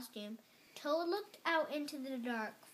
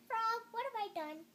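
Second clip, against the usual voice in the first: high-pitched dismayed cry; 11 semitones higher than usual. A narrator's reading. Toad realizing Frog is gone, high dismayed cry. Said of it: "cried Toad".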